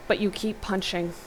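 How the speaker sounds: ordinary delivery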